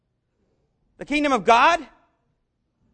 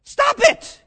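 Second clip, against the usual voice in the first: sharp, much higher, louder "Stop it!"; indignant outburst; 13 semitones higher, 8 dB louder than usual. Retold or imitated. Jesus scolding the disciples, a sudden high angry shout. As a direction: snap it out like an indignant teacher. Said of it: "He was like".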